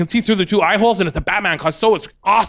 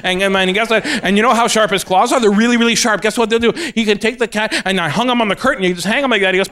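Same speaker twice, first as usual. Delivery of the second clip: usual speaking voice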